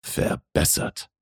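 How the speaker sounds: hushed, slow male correction; correcting man. A narrator's reading. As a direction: draw the word out softly to correct her. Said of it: "korrigierte er". (leise, langsame Korrektur)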